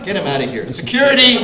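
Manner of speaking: hammed-up shout for security; calling security. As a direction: yell for the guards in fake outrage. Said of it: "where you go".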